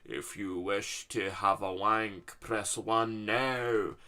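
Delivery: very slow, drawn-out eldritch voice; otherworldly call-centre growl, stretched out; Cthulhu. Acted. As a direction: intone it like a monstrous phone-line menu. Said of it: "like this deep voice that no one was ever meant to hear".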